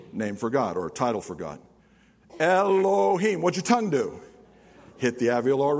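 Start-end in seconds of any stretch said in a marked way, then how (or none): 2.4-3.4 Elohim drawn out slowly, clearly. Shown as a demonstration. Pastor leading the church in saying Elohim, slowly. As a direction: spell Elohim out slowly for the congregation. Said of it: "Say, "Elohim".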